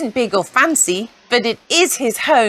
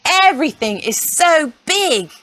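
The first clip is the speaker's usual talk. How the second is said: ordinary delivery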